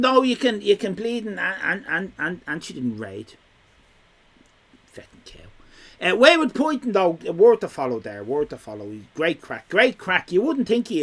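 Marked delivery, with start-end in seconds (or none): 4.9-6.1 slow, soft whispered jibe; whispered aside. Real, not acted. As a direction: whisper it so chat barely hears. Muttered insult aside.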